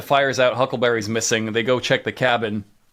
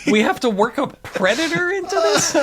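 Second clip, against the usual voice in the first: higher, exasperated and laughing; incredulous writers; 9 semitones higher than usual. Retold or imitated. Writers scrambling to fit a Predator in, exasperated and laughing. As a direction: play the baffled writers' room.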